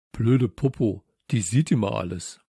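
a touch of huffy annoyance; huffy grumble. Acted. Samson complaining about the teacher on watch, huffy. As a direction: grumble it with a slight huff. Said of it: "schnaufte Samson".

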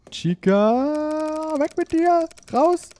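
very high squeaky voice; squeaky shooing voice. Pretend. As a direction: shoo her off in a squeak.

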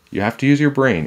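exasperated telling-off; brotherly scolding. A narrator's reading. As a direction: lecture her with emphasis. Jack scolds Annie.

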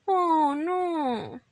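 little rabbit's crestfallen groan; murmured dismay. Acted. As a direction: sigh it out in disappointment. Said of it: "murmuró Roda".

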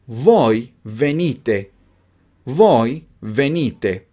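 slow, each word set apart; slow modelling. Shown as a demonstration. Teacher gives learners the conjugation to repeat, slowly.

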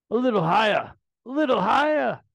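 high, loud put-on character voice. Fan voices a card character, high and loud.

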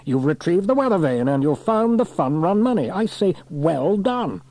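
high, hearty officer's praise; congratulating station officer. A narrator's reading. Station officer congratulating Sam and Trevor, hearty. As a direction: praise the crew with gusto, stretching the ending.

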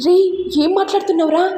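alarmed, fast pleading; tense and anxious. A narrator's reading. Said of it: "ఇక Lahari tension పడుతూ".

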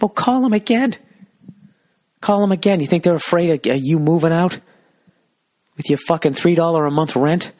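high, riled-up rant; heated retelling of an argument; retold angry comeback.

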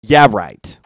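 low, loud sarcastic scoff. Real, not acted. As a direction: shut the fantasy down flat out loud. Sarcastic dismissal after fantasy.

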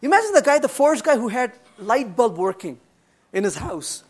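normal talking voice

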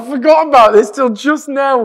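high, very loud blurt; excited outburst. Real, not acted. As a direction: burst out at the sudden memory. Sudden recall, amused outrage.

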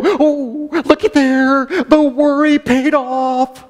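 high, sing-out gleeful marvelling; mock delighted amazement. Worrier finding a buffet in the morning, mock delighted amazement. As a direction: marvel high and laughing, stretching the last words.

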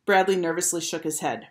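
plain reading voice

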